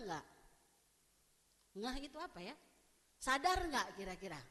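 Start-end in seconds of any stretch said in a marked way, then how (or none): none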